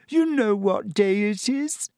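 high, slow, tearful schoolgirl; breaking into a cry at the end; tragic Lavender. A narrator's reading. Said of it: "said Lavender tragically".

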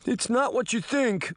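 put-on high, pleading boy's voice; lightly voiced frightened boy. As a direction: plead your innocence, scared. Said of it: "he whimpered like a dog".

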